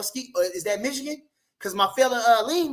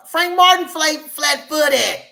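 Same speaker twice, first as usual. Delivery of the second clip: louder and higher, scoffing at flat feet; loud mocking jab; 4 semitones higher, 8 dB louder, slower than usual. Real, not acted. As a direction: call him out loud and mocking. Mocking rival fighter's style.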